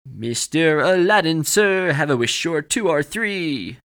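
loud, high, drawn-out cartoon genie; big showy genie proclamation; Robin Williams genie. A narrator's reading. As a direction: belt it out like a theatrical cartoon showman. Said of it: "his best Robin Williams genie voice".

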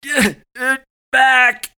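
slow, high, loud strained cry; agonised groan. A narrator's reading. Chance clutching his sides as his body changes, groaning. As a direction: force it out through great pain. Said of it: "Chance groaned".

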